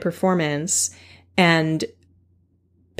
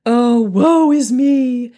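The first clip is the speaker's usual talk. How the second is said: slow, louder, hammed-up woe-is-me wail; mock self-pity; 3 semitones higher, 5 dB louder, slower than usual. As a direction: lay the self-pity on thick, slowly. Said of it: "A pity party is".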